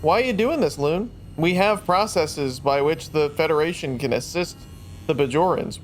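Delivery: ordinary talk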